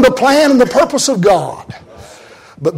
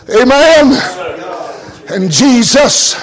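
normal talking voice

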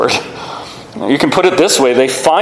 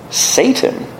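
"Satan" whispered, pitched up; whispered name; 10 semitones higher than usual. Retold or imitated. Jesus names Peter Satan, in a whisper. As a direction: breathe "Satan" out with no voice. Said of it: "He calls Peter".

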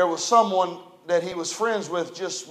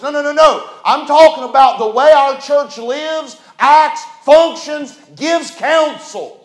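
loud, higher, emphatic sermon climax; preaching; 5 semitones higher, 9 dB louder than usual. Acted. Preacher insisting to his church, preaching at full force. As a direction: build it up and preach it hard.

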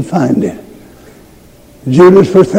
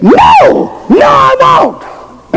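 high, slow, shouted refusal; a stretched, shouting no, much higher; defiant cry; slower than usual. Retold or imitated. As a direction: fling the no at him as you bolt. Girl refuses preacher, runs out.

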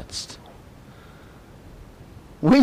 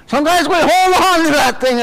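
high, raised preacher's voice; emphatic preaching; 10 semitones higher, 5 dB louder than usual. Real, not acted. Preacher confessing to congregation we cling to grudges, emphatic. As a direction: push it up high, stretching out the clinging.

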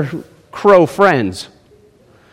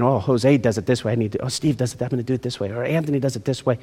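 comparing self; 3 semitones lower, 9 dB quieter, faster than usual. Retold or imitated. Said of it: "my life saying".

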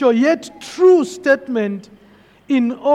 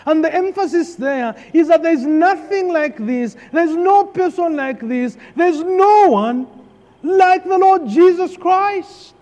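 building sermon; 3 semitones higher than usual. Acted. Preaching build.

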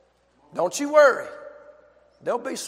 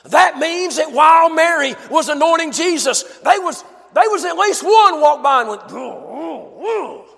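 raised, driving sermon voice; fervent preaching; 9 semitones higher, 9 dB louder than usual. Real, not acted. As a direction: preach it with fire. Preaching at full force.

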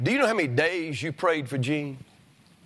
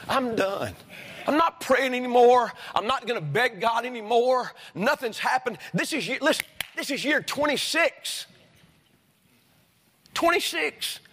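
weary despair; 6 semitones higher than usual. Acted. Voicing discouraged praying woman.

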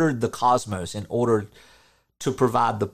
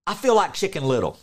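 raised, higher mock yell; retelling yelling out alone while walking, a higher mock shout; retold yell; 7 semitones higher, 3 dB louder than usual. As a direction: call it out loud, half in jest. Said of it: "I yelled out the other day".

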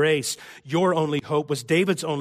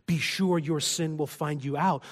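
ordinary delivery

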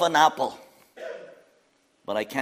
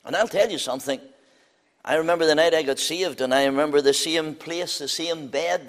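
ordinary talk, nothing marked

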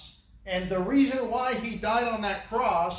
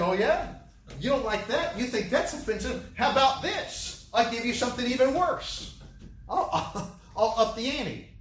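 higher, teasing, challenging tone; mock defiant Jesus; 5 semitones higher than usual. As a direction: taunt them playfully, raising the stakes. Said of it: "He said".

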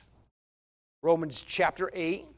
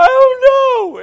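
much higher and louder, a hammed-up helpless wail; whiny tearful reply; 18 dB louder, slower than usual. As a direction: play the one crying who can't explain. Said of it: "acted reply of crying person".

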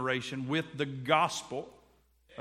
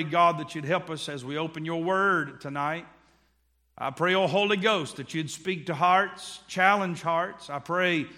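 earnest preacher's prayer; 3 semitones higher, 5 dB louder than usual. Acted. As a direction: pray over the congregation. Leads congregation in prayer.

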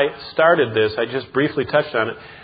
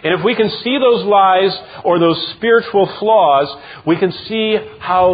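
higher and louder, slowing on the lies line; preaching at full force; 6 semitones higher, 4 dB louder than usual. Acted. Pastor exposing Satan's lies, preaching at full force. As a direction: build it up from the pulpit.

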